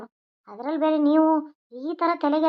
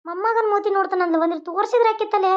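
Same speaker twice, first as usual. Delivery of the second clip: ordinary talk, nothing marked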